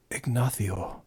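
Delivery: Ignacio low, Castilian "th" for the c; Spanish flair. Shown as a demonstration. Streamer proving the accent is convincing, low Spanish Ignacio with "th". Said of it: "with the Spanish flair".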